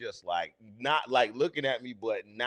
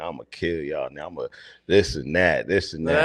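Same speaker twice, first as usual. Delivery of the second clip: low-pitched boasting, building louder; trash talk; 9 semitones lower, 3 dB louder than usual. Retold or imitated. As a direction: imitate your own cocky trash talk. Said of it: "I was talking heavy shit".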